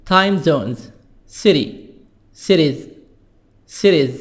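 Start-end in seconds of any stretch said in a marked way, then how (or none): 1.3-4.1 "City" said as siri, T tapped like a soft D; flap T. Shown as a demonstration. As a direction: tap the T in "Cities" to a quick D. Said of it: "T soft D".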